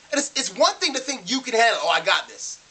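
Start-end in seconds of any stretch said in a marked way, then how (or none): 1.8-2.6 low, self-assured imitation of an overconfident driver; mock cocky. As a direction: brush it off with mock swagger, pitched down. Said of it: "like, popping your collar".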